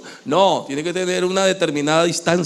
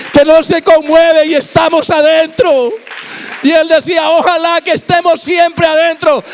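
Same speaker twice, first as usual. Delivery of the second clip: higher, loud, impassioned sermon delivery; fiery preaching; 10 semitones higher, 5 dB louder than usual. Acted. Preacher stirs the congregation, loud and impassioned. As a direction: preach it hard, voice raised to the rafters.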